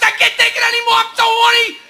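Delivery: desperate soldier. Acted. Soldier complaining in foxhole.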